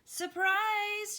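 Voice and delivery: high, loud cheerful shout; shouted surprise. A narrator's reading. Amelia Bedelia shouts back at the ladies, high. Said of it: "shouted Amelia Bedelia".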